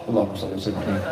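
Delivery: low, soft, mumbled under the breath; careless smoker's prayer. Retold or imitated. Man reciting blessings while smoking, low soft careless mumble. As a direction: toss off the prayer lazily.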